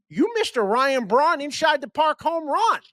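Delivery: put-on high, slow Milwaukee fan accent with a rising end. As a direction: play a proud Midwest Brewers fan, stretched out and lifted high. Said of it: "decent Milwaukee impersonation".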